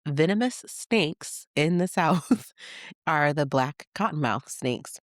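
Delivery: ordinary delivery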